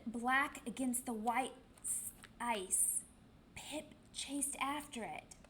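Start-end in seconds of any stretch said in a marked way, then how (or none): none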